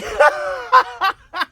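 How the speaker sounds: high, loud burst of laughter; loud cackling laugh. Real, not acted. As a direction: crack up at your own impression. Laughs at own impression.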